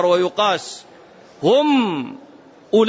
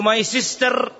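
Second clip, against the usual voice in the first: English phrase; 4 semitones higher than usual. Retold or imitated. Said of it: "بعض الناس من المسلمين يقولوا".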